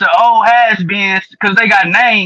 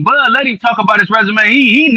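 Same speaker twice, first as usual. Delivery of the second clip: normal talking voice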